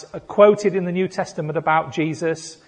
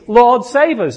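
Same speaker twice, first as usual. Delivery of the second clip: louder, higher heartfelt plea; earnest cry; 7 semitones higher, 8 dB louder than usual. Real, not acted. Pastor calling out to the congregation, an earnest loud plea. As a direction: cry it out like a real prayer. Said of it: "my cry is".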